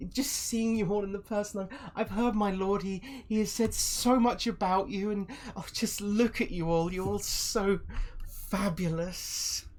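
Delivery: high, loud put-on fawning voice; gushing villain. Villain meets the famous party at last, high and fawning. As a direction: gush over them in a high voice, stretching the last praise.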